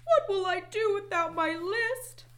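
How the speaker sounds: high, loud storybook wail; frantic, high-pitched dismay; panicked cry. A narrator's reading. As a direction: cry out in rising panic as Toad. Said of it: "cried Toad".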